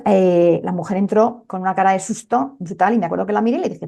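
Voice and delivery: ordinary talk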